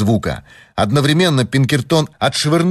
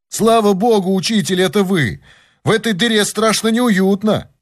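high-pitched voice calling up; eager, relieved high voice; relieved young helper; 9 semitones higher than usual. A narrator's reading. Helper answering his teacher from the well, relieved.

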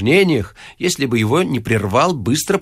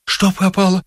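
higher, servile question; obsequious; 7 semitones higher than usual. A narrator's reading. Vorobyaninov asking Ostap what is lost, obsequious. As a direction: ask it fawningly. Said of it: "угодливо спросил Воробьянинов".

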